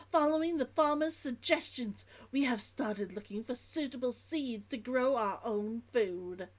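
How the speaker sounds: put-on dropped H's, Old London merchant's log; Old London accent. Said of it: "I'm making it more Old London".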